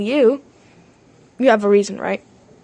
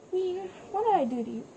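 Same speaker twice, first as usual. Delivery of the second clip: quiet rushed take; 4 semitones higher, 14 dB quieter, much faster than usual. Acted. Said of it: "I'm just getting really mad".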